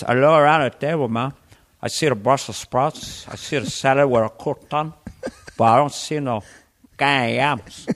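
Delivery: put-on comic Scarface-style Cuban accent; measured rhythm in a Cuban accent; Tony Montana accent. Tony Montana surveying Thanksgiving dinner, Cuban accent. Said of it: "Tony Montana at Thanksgiving dinner".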